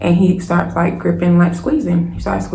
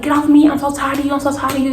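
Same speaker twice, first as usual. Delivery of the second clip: high, exasperated mock yell; retold yelling; 8 semitones higher, faster than usual. Woman fed up with being attacked, retold yelling. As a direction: re-enact a fed-up outburst without full volume. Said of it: "I'm yelling over and over again".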